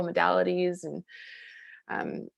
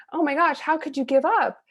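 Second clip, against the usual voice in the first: 1 dismayed disbelief; 8 semitones higher, 4 dB louder than usual. Retold or imitated. Retold dismay at father quitting.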